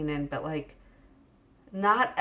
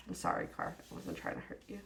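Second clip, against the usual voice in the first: hushed whisper to a hurt thing; very soft, voiceless apology; 14 dB quieter, faster than usual. Pretend. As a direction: whisper a little playful sorry. Apologizing to the card.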